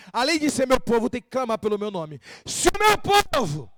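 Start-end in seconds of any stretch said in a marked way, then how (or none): 2.5-3.7 high, loud, slow declamation; ringing, stretched preacher's shout; scripture proclamation. Acted. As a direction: belt out the verse's opening.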